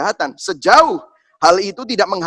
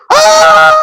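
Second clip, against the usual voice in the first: very high, loud shriek; child's scream imitation; 12 dB louder than usual. Toddler seeing five needles, imitated shriek. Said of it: "dia tuh teriak-teriak".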